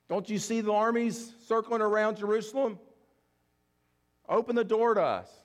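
loud frantic plea of the shut-out bridesmaids; desperate pleading shout. Acted. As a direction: hammer on the door and plead to be let in. Foolish bridesmaids banging on door.

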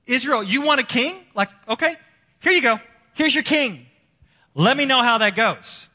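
high, loud, mocking "here you go"; sarcastic God. God answering Israel's demand for a king, mockingly loud. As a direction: hand it over with sarcastic glee. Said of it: "sometimes God will say".

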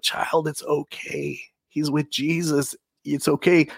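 put-on gentle Southern-accented reassurance, slow and soft at first; softened Southern drawl. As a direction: lightly imitate an elderly Southern woman consoling someone. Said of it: "she still had a Southern accent".

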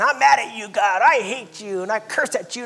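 loud, high shout of mock anger; angry outburst at God. People yelling their anger at God, loud mock fury. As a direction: hurl it up at God like a furious sinner.

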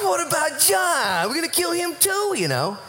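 preacher hams up Peter's high teasing question; Peter being funny. Retold or imitated. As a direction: needle Jesus about John in a high comic voice. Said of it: "Peter's so funny".

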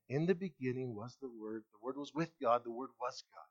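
hushed scripture reading. Acted. Quiet reading of John one.

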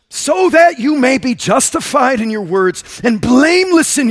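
loud, impassioned psalm reading; fervent scripture reading. Acted. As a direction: pray the words with full force. Reads Psalm 51 aloud.

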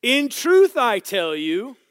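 high, slow, booming scripture declaration; loud proclamation. Acted. Preacher reading the verse to the room, loudly proclaimed.

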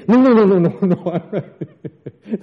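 loud, high denial breaking into laughter; frantic mock denial. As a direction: pile up the no's, then crack up. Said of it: "neutralizes the thought of anger and says".

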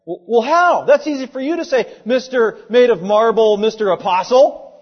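loud, high sarcastic retort; frustrated objector. Acted. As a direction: act out an exasperated comeback to a preacher. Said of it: "that would be very frustrating".

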